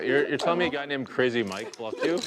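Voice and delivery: loud, high disbelief; can't believe what he's hearing; incredulous. Real, not acted. Hears the nickname Crazy Mike.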